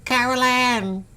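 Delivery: very high, slow, drawn-out eerie call; spooky movie medium. Retold or imitated. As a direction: call the lost girl like a creepy medium.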